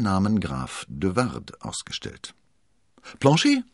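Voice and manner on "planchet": very high, loud summons; playful, rising shout; high-spirited call. A narrator's reading. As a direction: call the servant's name exuberantly. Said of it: "rief er übermütig".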